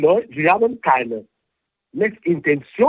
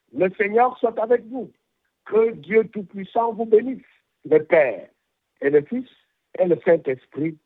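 higher, solemn liturgical blessing in French; 4 semitones higher than usual. Real, not acted. Bishop repeating the blessing in French, solemnly intoned. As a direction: intone the blessing as a priest would. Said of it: "zuerst in Deutsch, äh, und auch in ... Französisch".

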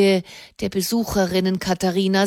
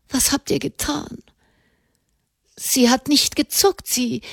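jammernd; 5 semitones higher than usual. A narrator's reading. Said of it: "jammerte Blanchefleur".

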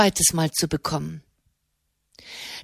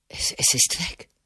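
stammered whisper, low and soft; a healed man whispers in awe; awed whisper; 8 semitones lower, 5 dB quieter than usual. A narrator's reading. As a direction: breathe it out in disbelief. Stunned at his healing.

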